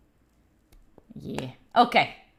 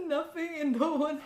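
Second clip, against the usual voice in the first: softer, with a pretend sob; a fake-tragic punchline to chat; mock sad reveal; 3 semitones higher, 9 dB quieter than usual. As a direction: sulk it out in a theatrical whimper.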